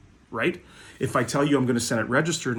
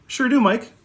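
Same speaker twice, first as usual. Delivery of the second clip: imitated cheery clerk; 12 semitones higher, 6 dB louder than usual. Clerk answering him cheerfully.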